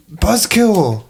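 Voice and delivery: ordinary delivery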